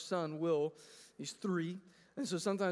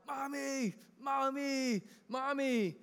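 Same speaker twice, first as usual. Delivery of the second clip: a hint of a small child calling out, higher; child calling mommy; 5 semitones higher, slower than usual. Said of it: "he starts crying out".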